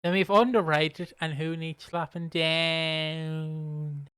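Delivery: very slow, stretched-out ending; drawn-out delivery. Real, not acted. Host listing award names, oddly drawn-out. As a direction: drag the final word right out. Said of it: "Why I did it like that?".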